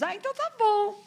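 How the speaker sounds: put-on very high, airy dismissive girl voice; mimicking the girl who shrugged off the crying woman; mock careless girl. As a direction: brush it off in a squeaky careless voice. Imitating careless church girl.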